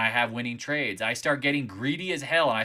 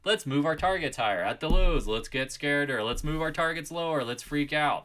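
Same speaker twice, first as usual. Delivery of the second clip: a hint of a greedy trader, louder on chase; lightly lampoon the eager gambler; mock retail trader; 8 dB louder than usual. Trader mocking retail buyers to his audience, lightly hammed-up. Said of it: "mocking greedy retail traders".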